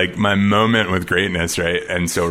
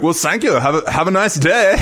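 higher, louder polite mountaineer voice; hammed-up gracious thank-you in an Austrian lilt; polite Tyrolean farewell; mock Messner accent; 11 semitones higher, 4 dB louder than usual. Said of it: "he's like".

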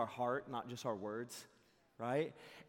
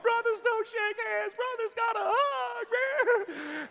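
high, slow, laughing shout; hammed-up ecstatic holler; exuberant cry; 7 dB louder, slower than usual. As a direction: bellow it joyfully while charging in for a hug. Exuberant cry running to hug.

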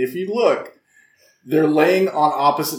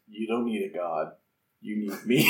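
ominous voiceover; 8 semitones lower, 11 dB quieter than usual. Retold or imitated. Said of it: "an ominous voiceover that goes".

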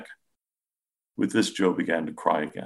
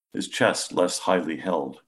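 usual speaking voice

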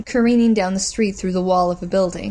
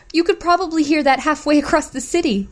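upset protest; 8 semitones higher than usual. Acted. Woman protests back.

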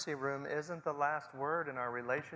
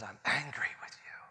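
hushed, breathy whisper of anger. Retold or imitated. Grieving mother whispering her anger at God. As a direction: whisper it like bitter grief. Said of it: "Maybe she says".

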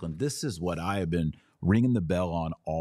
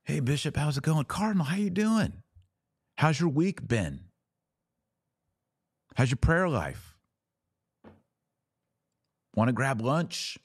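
hammed-up chummy small talk, pitched higher; mock friendly greeting; 9 semitones higher than usual. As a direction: play the fake-friendly colleague, all smiles. Mock friendly chat with bishops.

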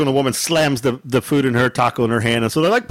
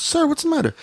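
nice manager; 13 semitones higher than usual. Retold or imitated. Nice manager asking upset customer, imitated. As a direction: lift the pitch to play her, polite. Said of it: "she was really nice".

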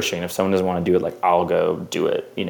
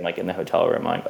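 ordinary talk

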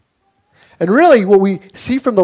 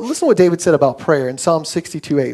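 ordinary talk, nothing marked

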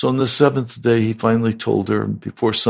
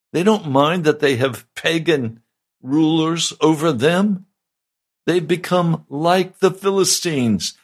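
mocking sarcasm; 6 semitones higher than usual. Preacher mocks Israelites' comfortable life.

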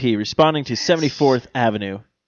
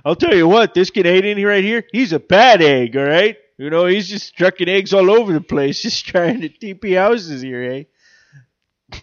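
put-on Canadian accent; 5 semitones higher, 4 dB louder than usual. Mock Canadian cop voice.

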